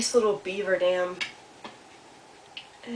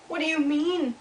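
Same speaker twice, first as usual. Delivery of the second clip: high, puzzled little voice; little beaver character; 7 semitones higher than usual. A narrator's reading. Little Beaver questioning Old Beaver, high puzzled voice.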